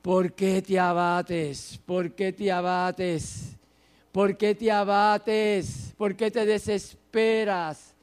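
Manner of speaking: slow, drawn-out repeated questioning; self-admonishing. Acted. Psalmist questioning his own downcast soul, admonishing. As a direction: press each question slowly, stretching the words. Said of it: "se habla a sí mismo y le dice".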